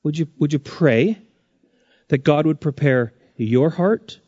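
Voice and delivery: ordinary talk, nothing marked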